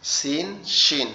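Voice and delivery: সিন then শিন, very slowly; slow contrast. Shown as a demonstration. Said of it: "নোক্তা সহ থাকে তাহলে সেটা হচ্ছে কি শিন".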